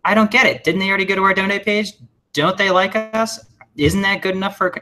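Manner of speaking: imitating a baffled client, whiny and high; puzzled mock whine. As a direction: play the confused colleague, indignant and high. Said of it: "And you're like".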